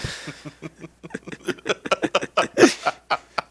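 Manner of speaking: long high giggle; laughter. Real, not acted. Laughing during play.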